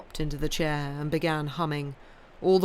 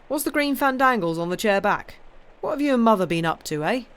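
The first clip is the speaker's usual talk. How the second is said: lightly voiced jovial husband, louder; a bluff man teasing his wife; hearty husband; 5 semitones higher, 5 dB louder than usual. A narrator's reading. Henry teases Anne in the garden, lightly hearty.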